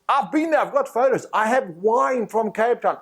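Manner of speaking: high, excited rush of delight; eager shopkeeper. Retold or imitated. Shopkeeper gushes to the South African tourist, high and excited. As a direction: gush about Cape Town to win the customer. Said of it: "And he went".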